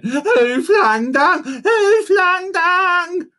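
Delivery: repeated cry. A narrator's reading. Monkey crying again and again, rising shouts. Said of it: "he cried again and again".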